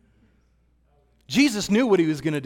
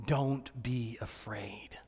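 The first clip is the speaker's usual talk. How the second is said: breathy whisper, slow and gentle; a slow whispered reassurance; whispered comfort; 5 semitones lower, 11 dB quieter, slower than usual. A narrator's reading. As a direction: soothe them in a hush. Jesus comforts frightened disciples.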